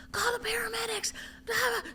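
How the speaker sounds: high-pitched frantic call; panicked. Retold or imitated. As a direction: cry out for help in panic. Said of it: "screaming his name".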